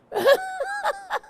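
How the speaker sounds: high delighted laugh; bright laugh breaking out; burst of laughter. Real, not acted. Laughing at the story.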